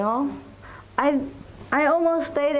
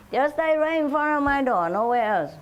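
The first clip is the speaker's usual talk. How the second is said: ordinary talk, nothing marked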